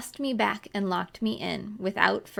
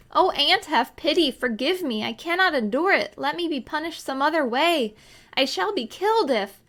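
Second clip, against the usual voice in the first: desperate pleading; 7 semitones higher, 4 dB louder than usual. A narrator's reading. Child begs aunt in terror.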